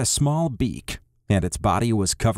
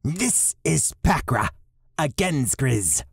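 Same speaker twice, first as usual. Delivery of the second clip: slightly higher, slower male character voice; lightly raised voice for the leader; Riz's voice; 4 semitones higher than usual. Acted. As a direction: voice Riz a touch higher and unhurried. Riz, a character voice.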